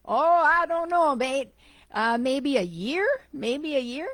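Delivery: high, loud, hammed-up Aussie accent; exaggerated Aussie drawn up high and loud; attempted Aussie accent. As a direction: lean hard into an amateur Australian cabbie voice. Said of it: "if I can do an Aussie accent".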